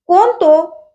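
mild hail, demanding who is there; calling out. A narrator's reading. Bhagya spots two figures in the yard, calling out. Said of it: "त्यानं हाकारलं".

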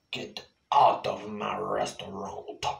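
a touch of an angry waiter; furious waiter. Acted. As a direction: order them out. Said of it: "pointed to the door".

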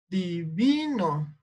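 diVIno, drawn out slowly on VI; stress on middle syllable. Shown as a demonstration. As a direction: stretch the VI in "Divino". (Divino marcando despacio la sílaba VI)

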